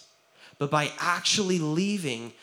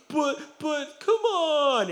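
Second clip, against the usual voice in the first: mocking protest; 12 semitones higher, much slower than usual. Crowd protesting a strong man.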